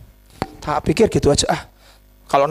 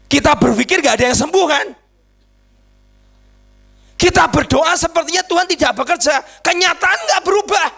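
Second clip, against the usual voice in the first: high, insistent preacher's voice; forceful preaching; 8 semitones higher than usual. Real, not acted. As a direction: drive it home to the congregation.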